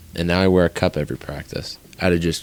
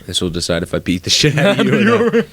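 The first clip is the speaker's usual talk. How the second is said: higher, quick, laughing mock threat; mock anime fighter; 8 semitones higher, faster than usual. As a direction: lightly play the Jujutsu Kaisen brawler. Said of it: "He sounds like this guy from this anime".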